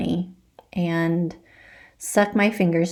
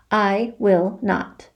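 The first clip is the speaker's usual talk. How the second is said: stubborn refusal; much slower than usual. Acted. Defiant arms folded refusal.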